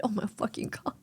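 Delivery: hushed whispered curse; whispering. Real, not acted. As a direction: breathe it out in a whisper. Unvoiced aside.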